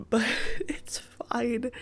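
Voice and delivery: breathy whispered aside, slowed; a self-mocking whisper, no voice. Real, not acted. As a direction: brush it off under your breath. Shrugging off sitting six hours.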